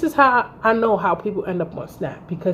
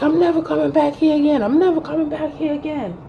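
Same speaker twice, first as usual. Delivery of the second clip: higher-pitched mocking quote of a man storming off; imitated boyfriend; 7 semitones higher than usual. Woman mimics boyfriend leaving after the fight, mocking. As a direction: mimic his repeated parting vow, pitched up.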